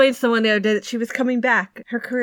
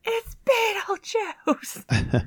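high, loud, stretched-out exasperated cry; mock despairing wail; exasperated outburst; 10 semitones higher, 3 dB louder, much slower than usual. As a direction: wail it in disbelief that they don't know the film. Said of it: "And I was like".

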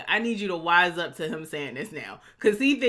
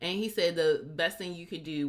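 ordinary talk, nothing marked

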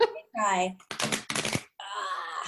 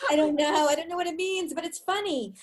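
higher, amused imitation of a puzzled buyer; mock confused reader; 6 semitones higher than usual. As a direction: echo the baffled reader, playful and light. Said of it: "Some people out there would be like".

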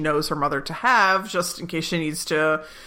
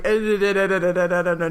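panicked stammer; faster than usual. Retold or imitated. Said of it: "Michael's like".

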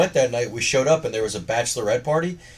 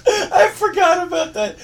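very high, slow, words squeezed out through laughter; tears-of-laughter wheeze; laughing so hard it almost sounds like crying; 3 dB louder, slower than usual. Real, not acted.

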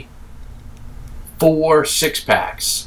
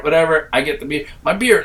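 ordinary delivery

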